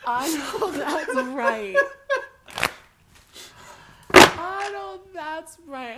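slow, high, loud laughing cheer; laughing hype. Real, not acted. Cheering co-host's fan antics.

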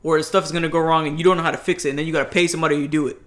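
normal talking voice

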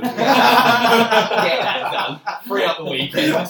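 loud, high and laughing, slow on get that done; laughing repeat. Real, not acted. Repeating his own joke to friends, laughing loudly. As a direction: burst out laughing through the words.

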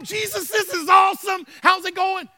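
high, loud, thrilled greeting; excited disciple. Retold or imitated. Preacher as disciple greeting Jesus, excited. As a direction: rush up to your hero in the middle of the party.

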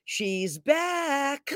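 playful sing-out, stretching the last word; mock sound effect. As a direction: announce a comeback like a sound effect. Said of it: "I wish I had a sound effect for".

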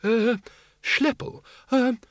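high, hesitant, quavering request. A narrator's reading. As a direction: ask a giant timidly, voice up high. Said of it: "Windle quavered".

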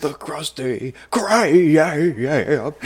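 very slow, lightly voiced line; drawn-out cartoon quote. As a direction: drag it out like the cartoon. Quoting the show.

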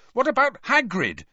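indignant angry question; angrily. A narrator's reading. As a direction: demand it hotly. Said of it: "Harry said angrily".

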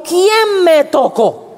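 a hint of a searching Jesus; lightly voiced Jesus asking; slow voiced Jesus. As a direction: draw out the question slowly.

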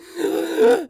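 loud startled gasp, high; sharp high gasp. Acted. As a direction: gasp in sudden alarm. Spirit gasp.

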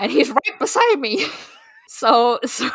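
giggling through the words; squealing with laughter as she speaks; laughing at the romance scene, giggling. Real, not acted. Laughing while reading steamy scene.

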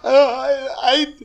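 high, pitiful lovelorn voice; timid, hopeful Tom. Acted. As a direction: drag it out toward a high tearful finish.

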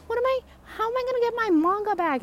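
very high, quick mock wail; exaggerated high-pitched fretting; self-mocking fretting. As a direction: mimic your own silly panic, high and hurried. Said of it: "how stupid th-, it sounds when I'm like".

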